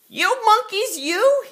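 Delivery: high, loud scolding shout; scolding monkeys. Acted. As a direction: shake your finger and yell at them. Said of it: "he said, shaking fingers at them".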